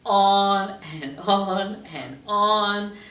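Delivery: slow drawn-out on and on; dragged out. Retold or imitated. Therapist mocking client's complaints, slow drawn out. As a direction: stretch it to sound endless.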